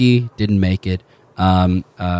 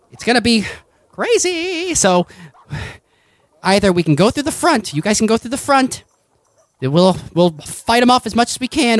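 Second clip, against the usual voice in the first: higher, urgent rebel leader laying out the plan; [name] briefing the team; 11 semitones higher than usual. Acted. Rebel leader briefing the party on the attack, higher and urgent. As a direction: pitch it up and brief them fast.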